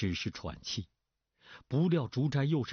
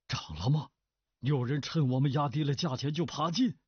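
sharp alarmed questions, pitch climbing; stern cry; 6 semitones higher than usual. A narrator's reading. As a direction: demand it sternly, rising in disbelief. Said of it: "厉声叫道". (厉声惊问)

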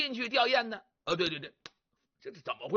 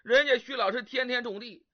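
usual speaking voice

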